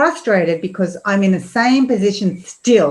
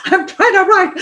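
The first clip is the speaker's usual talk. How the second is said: high, louder mock exasperated yell; a busy writer snapping at her kids; frustrated yell; 12 semitones higher, 5 dB louder than usual. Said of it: "It's like".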